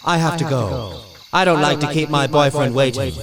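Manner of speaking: 1 dismissive ghost. Acted. Ghost leaves the visitors.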